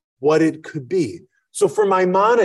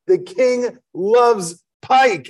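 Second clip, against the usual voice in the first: drawn out slowly and raised, savouring each word; admiring chef; 5 semitones higher, much slower than usual. Acted. Chef gloats over the king's pike, in a slow raised voice. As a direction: linger over it like a tasty dish.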